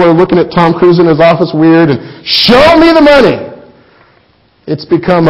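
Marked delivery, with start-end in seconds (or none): 2.3-3.4 high, loud shouted chant; yelling back and forth. Retold or imitated. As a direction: yell it over the phone. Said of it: "both yelling back and forth".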